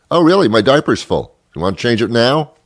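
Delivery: mock the waiter with a sarcastic squeal; sarcastic retort. Comic to the waiter, high sarcastic mockery.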